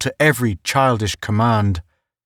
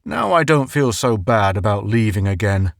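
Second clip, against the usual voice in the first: ordinary delivery, nothing marked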